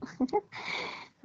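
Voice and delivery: laugh. Acted. Laughs at his retort.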